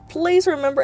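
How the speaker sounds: ordinary talk, nothing marked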